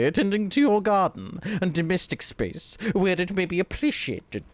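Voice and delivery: loud, haughty posh professor; snooty voice. Pretend. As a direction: read it down your nose, loud and high. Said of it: "read this in a very snooty voice".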